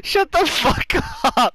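loud, very high laughing yell; laughing outburst. Real, not acted. Telling the teasing friend off, laughing yell.

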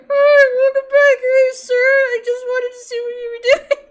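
high, loud boyish voice imitation; squeaky puberty-boy voice; mock preteen voice. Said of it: "instead of like this voice".

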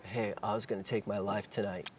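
very soft, hushed confession; retold whisper. Tough stranger confessing in a bear hug, hushed whisper. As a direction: drop to a near whisper, as if in his ear. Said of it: "whispers in my ear".

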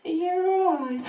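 high, loud, slow mum voice; exaggerated worried mother; shrill-free high caring mum call; mum's concern. Mum checking on him.

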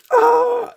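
loud, high mock yell of pain; pained scream. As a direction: act out a kid's scream of pain. Finger hurt, cries out in retelling.

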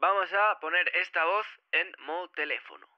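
thin, filtered phone-line voice; telephone effect. Shown as a demonstration. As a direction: sound like an old radio. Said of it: "La de telephone sonaría algo así". (voz telefónica filtrada)

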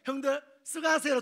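cheery brother. Acted. Joseph leaves brothers cheerfully.

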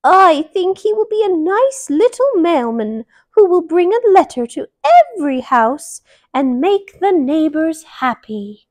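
high, loud granny voice; grandma. Acted. Grandma bunny proposing the mailman, in a high loud granny voice.

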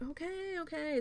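high, soft mock giving-in; placating mother-in-law.

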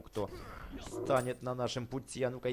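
ordinary talk, nothing marked

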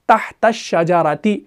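"तहत शजराती" very slow, ta run straight into shin; slow, deliberate linking; joined recitation. Shown as a demonstration. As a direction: link "तहत शजराती" slowly. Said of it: "ता को मिलाया शीन के साथ".

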